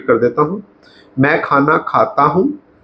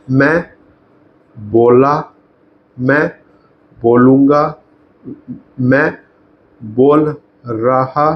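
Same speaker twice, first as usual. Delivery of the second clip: drawn-out words with pauses between; slow dictation; slower than usual. Shown as a demonstration. Teacher writing out tense forms of one line, slow dictation. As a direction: dictate piece by piece while writing.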